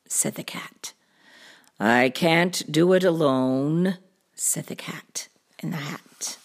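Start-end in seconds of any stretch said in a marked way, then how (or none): 1.8-4.0 low cartoon cat voice; deep storybook cat; pondering cat. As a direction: admit it in a deep voice. Cat admits needing help.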